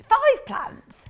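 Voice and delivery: usual speaking voice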